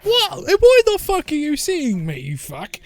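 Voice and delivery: high, loud indignant outburst; high-pitched hammed-up outrage, dropping at the end; outraged disbelief. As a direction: shriek it, affronted at being spotted. Invisible villain acted, outraged at being spotted.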